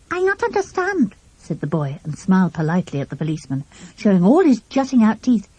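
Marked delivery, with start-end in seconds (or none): 0.1-1.1 put-on French accent. A narrator's reading. French boy repeats.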